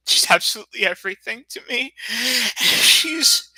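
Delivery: choking up over the woman he loves; voice breaking. Real, not acted. Streamer telling his chat about the woman he loves, voice cracking. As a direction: pitch climbs high as the voice cracks with emotion. Tearful declaration of love.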